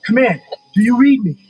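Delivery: urgent radio shout. Acted. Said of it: "repeats the radio call".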